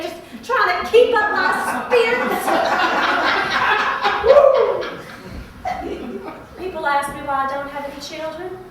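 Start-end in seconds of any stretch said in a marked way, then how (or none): 2.5-6.5 airy giggling laugh; nervous laugh at herself; breathy laugh. Acted. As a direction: laugh it off to keep spirits up. Laughs after fourth Coca-Cola.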